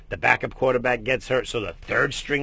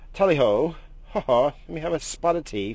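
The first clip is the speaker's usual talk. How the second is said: higher mock British accent, hammed-up; a silly put-on British gent asking for tea; mock posh British accent; 5 semitones higher, 3 dB louder than usual.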